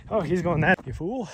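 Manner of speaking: loud, excited dog voicing the pet; dog's voice. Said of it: "comes sprinting back like".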